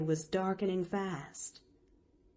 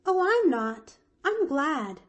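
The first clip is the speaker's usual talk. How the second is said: put-on high, louder, delighted little girl; glad; 9 semitones higher, 6 dB louder than usual. Pollyanna welcomes bread and milk, high and delighted. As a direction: burst out gladly.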